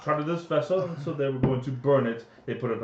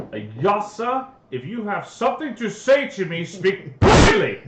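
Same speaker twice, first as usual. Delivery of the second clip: high shout building to the last word; angry demand; 6 semitones higher, 7 dB louder than usual. Acted. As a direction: demand it loudly, exploding at the end. Paladin demands a straight answer in game.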